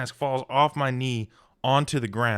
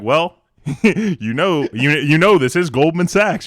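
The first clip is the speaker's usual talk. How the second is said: louder, slow hammed-up smug barber; smug mock barber; 6 semitones higher, 11 dB louder than usual. Barber explaining the fancy liquor to an employee, in a loud, slow smug voice. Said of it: "He's like, he's like".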